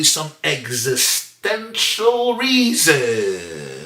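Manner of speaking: slow, drawn-out mock importance; mock grandiosity. Teacher guessing why he's angry at his wife, mock grandiosity. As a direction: stretch the big word out theatrically.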